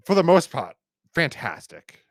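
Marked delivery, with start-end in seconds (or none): none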